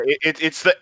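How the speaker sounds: ordinary delivery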